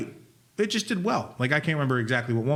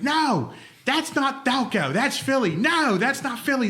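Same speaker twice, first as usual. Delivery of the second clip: high, insistent contradicting; mock bickering; 13 semitones higher, slower than usual. Locals arguing Delco versus Philly, mock-indignant and high. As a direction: imitate people squabbling, pitched way up. Mimicking commenters arguing over accents.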